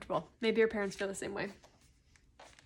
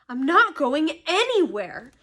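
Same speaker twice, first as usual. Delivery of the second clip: loud, defiant refusal; fierce; 5 semitones higher, 7 dB louder than usual. A narrator's reading. Harry refusing to flee, fierce. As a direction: stand firm and push the words out. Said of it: "said Harry fiercely".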